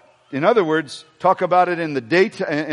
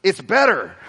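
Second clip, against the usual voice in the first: mock voice; 3 semitones higher than usual. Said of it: "Those are great voices".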